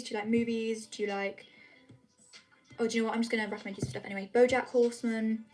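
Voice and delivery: ordinary talk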